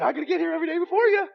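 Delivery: high, quick, eager rookie voice; eager young Brady. Retold or imitated. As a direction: rattle it off high and keen like a hungry rookie. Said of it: "He goes".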